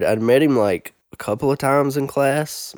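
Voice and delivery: ordinary delivery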